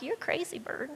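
softly muttered aside; unimpressed kitten. Acted. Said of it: "looks at him like".

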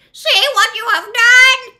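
very high, piercing witch shriek; full-force screaming witch; witch's scream. A narrator's reading. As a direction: scream the accusation in horror. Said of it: "she screamed".

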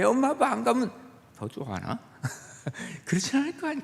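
ordinary talk

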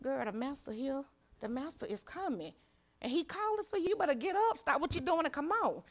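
hushed, quiet nudge to a sister; secretive urging. Acted. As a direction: urge her softly, keep it secret. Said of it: "secretly, saying".

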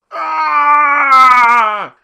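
high loud shriek of pain; burning scream. Acted. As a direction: scream as if set on fire. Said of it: "cried out King George three as he burned".

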